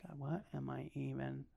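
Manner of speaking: barely voiced whisper to God; hushed despairing whisper; whispered doubt. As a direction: breathe it out like a private doubt. Whispered inner prayer.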